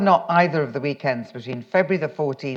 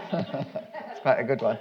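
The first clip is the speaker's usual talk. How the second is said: slow, soft words through a laugh; amused, still chuckling; 3 semitones lower, 6 dB quieter, slower than usual. Real, not acted. Enjoying her own reading slip, speaks softly while still laughing.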